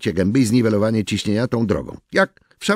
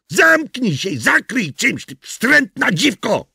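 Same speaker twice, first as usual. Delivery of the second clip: higher, slow shouted abuse; yelled insult; 13 semitones higher, slower than usual. Acted. Garbage man yelling up at naked neighbour. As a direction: scream up at her. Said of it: "wrzasnąłem".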